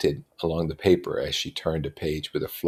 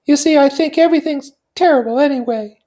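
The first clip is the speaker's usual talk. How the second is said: put-on high, light young society woman; Daisy's voice; 9 dB louder than usual. Daisy confiding in her cousin Nick, high and light. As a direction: lift into a bright, high feminine voice.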